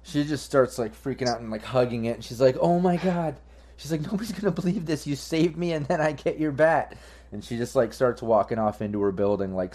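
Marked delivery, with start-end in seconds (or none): 2.6-7.0 high, giddy fan voice; thrilled squealing gratitude; overjoyed fan. Acted. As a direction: bubble over with delight. Fan overjoyed with signed bat.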